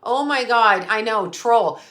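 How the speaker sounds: ordinary talk, nothing marked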